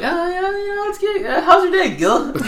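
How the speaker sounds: very high-pitched nervous dodge; mock evasive stalling. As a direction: squeak through a hammed-up change of subject. Acting out dodging Gil's question.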